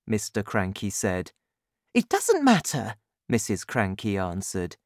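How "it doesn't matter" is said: high-pitched woman's voice; high, insistent wife; insistent mother. A narrator's reading. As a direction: brush off the objection, pitched high. Wife argues back.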